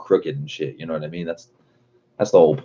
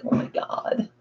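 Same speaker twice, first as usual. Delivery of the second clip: mocking sob; 8 dB quieter, slower than usual. Mocking the crying mom and daughter.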